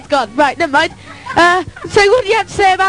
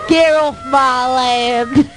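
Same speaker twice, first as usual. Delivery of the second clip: imitating farmer voice; 5 semitones lower, much slower than usual. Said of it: "as my husband said".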